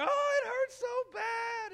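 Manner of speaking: high, slow, loud crying wail; childish wail. Retold or imitated. Preacher imitating himself as a child crying to granny. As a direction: wail it like a hurting four-year-old. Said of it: "And so I'm like".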